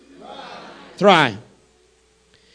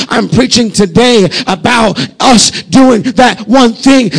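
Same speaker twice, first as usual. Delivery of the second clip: loud, high full-force preaching; preaching climax; 5 semitones higher, 7 dB louder than usual. Real, not acted. Pastor urging his church to commit everything, loud preaching. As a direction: drive it home with fervour.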